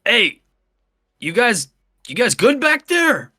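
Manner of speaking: high-pitched yell to friends out of sight; calling out. Acted. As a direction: holler to check on the others. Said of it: "yell out".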